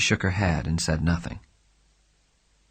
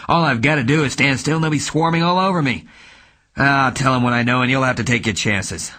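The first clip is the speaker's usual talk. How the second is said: louder, hard angry voice; detective warns he will talk; 5 semitones higher, 5 dB louder than usual. Acted. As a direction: threaten her coldly.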